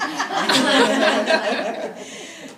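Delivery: loud burst of real laughter; a loud, high, open laugh; big laugh. Real, not acted. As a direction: laugh out at your own joke. Laughs at own joke.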